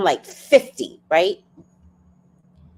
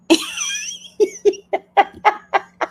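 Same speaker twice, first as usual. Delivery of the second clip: high, loud burst of laughter; 4 dB louder than usual. Real, not acted. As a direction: let a loud laugh explode. Laughing at her own story.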